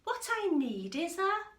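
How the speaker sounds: high, loud declaration rising to roar; excited mouse. Acted. The mouse telling himself he needs a roar, loud and high. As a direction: announce the plan with a big roar. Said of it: "he said".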